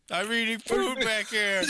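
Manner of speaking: high, loud, slow goofy voice; mock silly voice. Friend teasing how he hid eating, in a hammed-up goofy voice. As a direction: ham up a dopey excuse.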